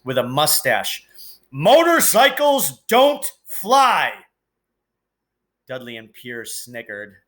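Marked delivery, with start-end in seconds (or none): 1.5-4.1 high, loud, slow shouted outburst; furious yell. Acted. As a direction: bellow it in disbelief. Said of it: "yelled at Harry".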